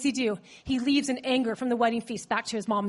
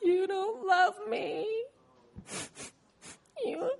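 mock crying; 6 semitones higher, 6 dB quieter, slower than usual. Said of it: "you know how you do it".

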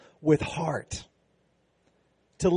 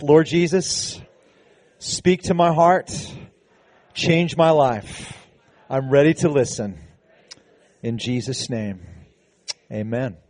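led prayer. Acted. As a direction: lead the congregation in prayer, phrase by phrase. Pastor leads congregation in prayer.

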